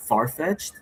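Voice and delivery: ordinary delivery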